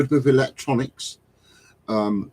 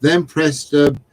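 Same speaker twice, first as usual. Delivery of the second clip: slow, louder, building into laughter; mock technical instructions; 4 semitones higher, 7 dB louder, slower than usual. Host reading out joke tech instructions, mock-serious then cracking up. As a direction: spell out the nonsense words with relish.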